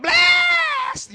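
"Blessed!" shouted high and loud, drawn out; enthusiastic church shout. Retold or imitated. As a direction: imitate a fired-up churchgoer. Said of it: "They're like".

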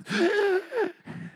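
high gasp for breath; sharp mock crying gasp; imitated sobbing gasp. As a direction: act out coming up for air mid-cry. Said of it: "I would come up for breath".

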